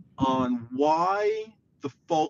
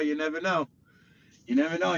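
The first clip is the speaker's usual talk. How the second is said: ordinary talk, nothing marked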